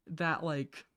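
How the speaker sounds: ordinary delivery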